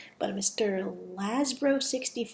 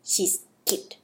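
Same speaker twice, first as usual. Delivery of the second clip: ordinary delivery, nothing marked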